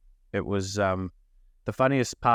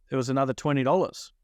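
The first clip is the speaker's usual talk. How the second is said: ordinary talk, nothing marked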